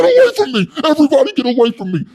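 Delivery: very high, loud, fast screaming; meltdown shout. Retold or imitated. Wes screaming at inmates after falling, very high and loud. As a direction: melt down and scream everyone away. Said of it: "totally melting down".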